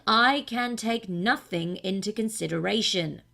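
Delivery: the cat cuts the king off, unimpressed. A narrator's reading. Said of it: "said the cat".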